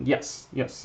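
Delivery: soft unvoiced aside; whispered aside. Real, not acted. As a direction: whisper yes, yes under breath. Aside while painting.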